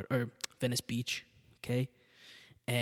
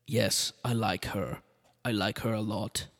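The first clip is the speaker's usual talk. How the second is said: put-on slow, mild Austrian accent; 2 semitones lower, slower than usual. Arnold taking a liking to the Kennedy, in a mild Austrian accent. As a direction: Austrian accent, not yet thick. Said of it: "The accent got worse".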